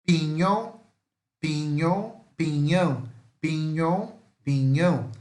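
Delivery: "Pinhão" in the Spanish way, ñ like NH; Spanish teacher drilling "Pinhão". Shown as a demonstration. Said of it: "O N com o tio em cima".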